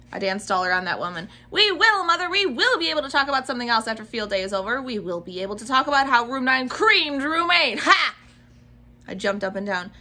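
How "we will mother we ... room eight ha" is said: fast, louder gleeful bragging; excited boasting. Acted. Junie B. dancing around mother, excitedly bragging. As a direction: brag while bouncing with joy.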